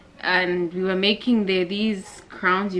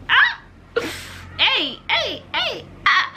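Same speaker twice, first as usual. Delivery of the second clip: excited squeal; 14 semitones higher than usual. Real, not acted. Excited laughing outburst.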